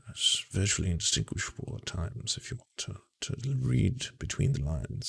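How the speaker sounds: soft, musing intellectual impression; mock a pundit in a quiet murmur; Christopher Hitchens. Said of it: "Christopher Hitchens loved to be like".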